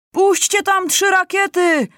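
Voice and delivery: high, loud, drawn-out command; shouted order. A narrator's reading. Lieutenant shouting at the soldiers, loud and slow. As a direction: shout the order across the trench. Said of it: "krzyknął porucznik".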